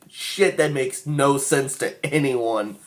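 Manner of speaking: usual speaking voice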